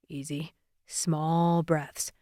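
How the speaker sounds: low and gentle. Acted. Firefighter calming the rescued boy, soft and gentle. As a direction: soothe a scared boy back to breathing. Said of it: "His voice is low now, gentle".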